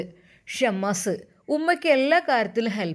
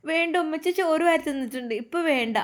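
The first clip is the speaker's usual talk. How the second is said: put-on higher little child's voice; small child; 7 semitones higher than usual. A narrator's reading. Child telling grandmother no food now.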